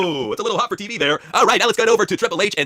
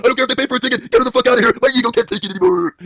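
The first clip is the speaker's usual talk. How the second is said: mock Triple H; 5 semitones higher than usual. Triple H ego rant.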